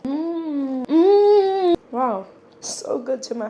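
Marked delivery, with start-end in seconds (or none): 0.9-1.8 loud, high moan of delight at the taste; pleasure moan. Real, not acted. Eating sweet bread alone, an exaggerated loud moan of pleasure. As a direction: savour it out loud. Tasting food, moaning with pleasure.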